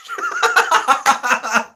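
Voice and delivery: high, falling burst of laughter; real laughter. Real, not acted. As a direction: crack up at your own joke. Joking about Godzilla's Revenge.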